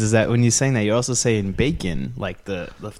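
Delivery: ordinary talk, nothing marked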